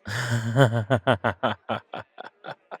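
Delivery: long, loud rippling laugh; flattered laugh. Acted. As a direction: laugh in a quick run that slowly dies away. Flattered laugh after a compliment.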